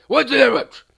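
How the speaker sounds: rough, explosive mock bellow; nasty sandwich guy's angry yell. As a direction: bark it at a customer, very loud. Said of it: "a really nasty little monster".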